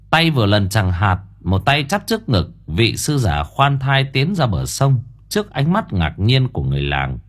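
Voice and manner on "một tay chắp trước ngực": usual speaking voice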